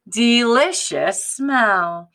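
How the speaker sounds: slow, savoring delicious smell; drawn-out delicious. Acted. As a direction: stretch it out with relish. Officer quote drawn out.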